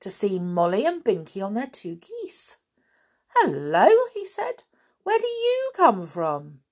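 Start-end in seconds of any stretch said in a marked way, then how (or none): 3.4-4.6 amazement. A narrator's reading. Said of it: "gaped in amazement". 5.0-6.6 high-pitched astonished question; amazed. A narrator's reading. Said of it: "gaped in amazement".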